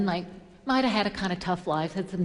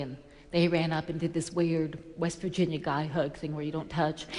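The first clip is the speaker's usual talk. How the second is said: usual speaking voice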